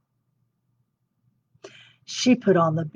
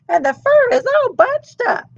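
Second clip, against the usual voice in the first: much higher, indignant huff; Mama Bear fussing over her boots; 4 dB louder than usual. Acted. Mama Bear finds worn boots, high huff. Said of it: "she huffed".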